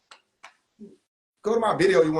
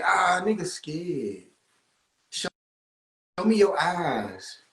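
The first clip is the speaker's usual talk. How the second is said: slow mocking taunt; much slower than usual.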